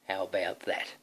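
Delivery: ordinary delivery, nothing marked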